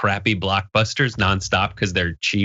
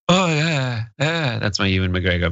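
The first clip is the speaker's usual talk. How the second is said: Ewan McGregor impression; 2 semitones higher than usual. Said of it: "Ewan McGregor's more, you know, he's like".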